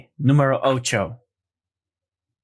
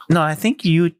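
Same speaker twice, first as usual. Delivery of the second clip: usual speaking voice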